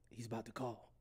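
low, very soft imitation of a nervous tip-off; hushed warning. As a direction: pass it on quietly, as if secretly. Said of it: "why are we whispering?".

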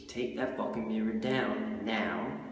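usual speaking voice

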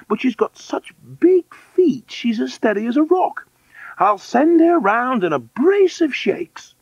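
very high, loud matron voice; orphanage matron. Acted.

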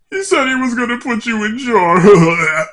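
forcing words out while gagging; retching between words; dry heaving. Real, not acted. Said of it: "Why the fuck did you get sick trying to tell me that?".

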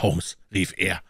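plain reading voice

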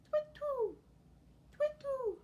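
high, sing-song owl call twit-too; owl hoot. Shown as a demonstration. For the children, a playful high owl hoot.

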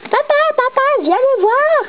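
put-on high child voice shouting papa; little child voice. Small child calls her father.

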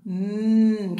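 "Mm" hummed as a long nasal mm; the n sound. Shown as a demonstration. Teacher modelling the letter N sound "Mm" for the class. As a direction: hum "Mm" for the n sound, lips closed. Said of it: "it makes a sound".

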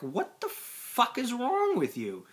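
high, slow disbelief; exasperated yell. Retold or imitated. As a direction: ask it in disbelief. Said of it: "be like".

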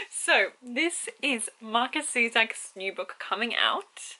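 ordinary talk, nothing marked

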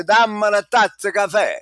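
lively Italian speech. Retold or imitated. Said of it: "and they say".

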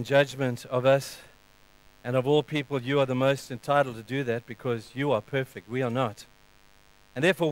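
soft, low, unhurried prayer voice. Acted. Pastor leading the congregation in prayer, hushed. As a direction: pray it quietly, with long pauses.

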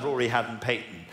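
ordinary talk, nothing marked

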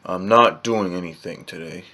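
ordinary talk, nothing marked

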